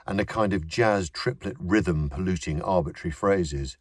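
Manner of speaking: ordinary delivery, nothing marked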